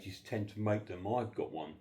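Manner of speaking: normal talking voice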